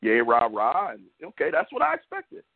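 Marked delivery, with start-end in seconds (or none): 0.0-0.9 mock cheer. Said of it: "all that bullshit they like to say".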